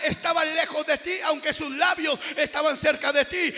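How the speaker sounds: loud, ringing preacher's cry over music; preaching at full force. Acted. Pastor crying out to the congregation in full preaching voice. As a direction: preach it out to the whole church.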